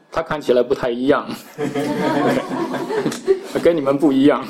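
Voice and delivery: amused quote with real laughter through it; laughing retelling. Real, not acted. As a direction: crack up while quoting someone. Retelling officer's remark, finds it funny.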